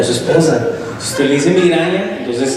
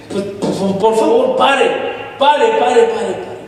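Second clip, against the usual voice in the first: pleading youth; 7 semitones higher, slower than usual. Retold or imitated. Youth begs him to stop.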